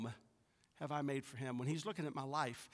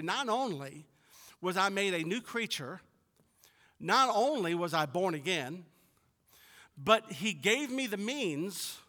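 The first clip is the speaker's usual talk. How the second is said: raised, emphatic preacher's delivery; louder, higher pulpit proclamation; preaching; 5 semitones higher, 7 dB louder than usual. Real, not acted. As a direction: preach it with building conviction. Preaching with force.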